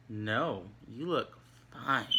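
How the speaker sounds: slow, drawn-out insincere reassurance; snarky. Shown as a demonstration. Answering "Do I look bad?", slow and snarky. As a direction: stretch it out so it sounds untrue. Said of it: "I'm kind of being snarky".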